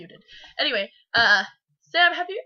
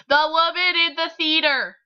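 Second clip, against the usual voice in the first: loud, raised-pitch title read; dramatic title announcement; 6 semitones higher, 6 dB louder than usual. Shown as a demonstration. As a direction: belt out the title for effect. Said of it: "we're gonna read it dramatically".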